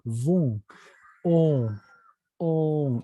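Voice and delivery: bon, on, each as an isolated nasal "on"; French O. Shown as a demonstration. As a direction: hold the French "on" sound by itself. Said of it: "Esa O francesa". ("on" con la O francesa)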